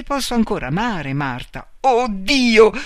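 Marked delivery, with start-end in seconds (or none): 1.8-2.7 loud high cry; shout. A narrator's reading. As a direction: cry out in horror. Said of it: "Gridò lei". (grido acuto di orrore)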